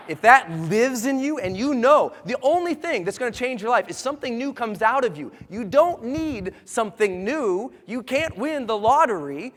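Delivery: rally the room like a preacher; rousing motivational preaching. Acted. Firing up the seminar crowd, loud rousing preaching.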